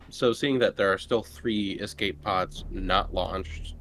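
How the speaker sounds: usual speaking voice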